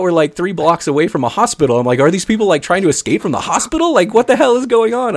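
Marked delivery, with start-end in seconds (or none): 2.0-5.1 high, bewildered questioning; totally freaked out. Retold or imitated. Storyteller wondering about wheelchairs near a hospital, totally freaked out. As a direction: demand an explanation, voice pitched up. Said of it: "I was just totally freaked out".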